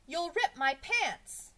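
high, loud, drawn-out protest; alarmed schoolboy yelling a warning; crying out. A narrator's reading. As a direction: cry out slowly in alarm. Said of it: "cried Jason".

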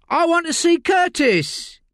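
high, coarse London voice of a desperate addict; Layla, rough addict. Acted. Addict Layla snapping at detective Josie in interview. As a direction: snap like a strung-out woman in custody.

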